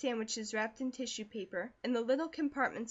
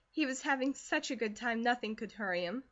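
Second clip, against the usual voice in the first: ordinary delivery, nothing marked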